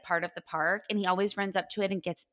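ordinary delivery

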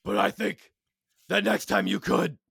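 Batman voice. Acted. Batman voice in table read.

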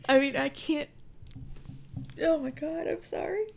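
real teary break, voice climbing high; choked up. Real, not acted. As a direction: voice rising high as tears come. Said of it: "I'm actually getting teary-eyed".

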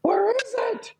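high mock yell of a frantic brother; imitating a frantic younger brother yelling; retold yell. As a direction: shout it high like a panicked kid.

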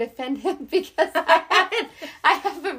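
self-mocking laugh through the words. Real, not acted. Friend confessing she can't sing, laughing at herself. Joking about own bad singing.

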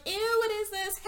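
high squeaky disgusted ew; a mock girly squeal of disgust; grossed-out squeal. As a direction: shriek it like a grossed-out teen. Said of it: "they're like".

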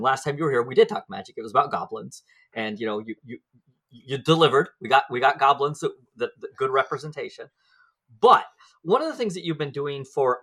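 normal talking voice

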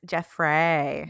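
Jeffrey said slowly as "Jeff-RAY"; deliberate "Jeff-ray" for Jeffrey; Scottish pronunciation. Shown as a demonstration. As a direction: end Jeffrey on a long "ray". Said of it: "Jeffrey in Scottish".

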